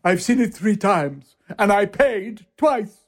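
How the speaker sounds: a lightly voiced gleeful Gielgud. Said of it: "He said".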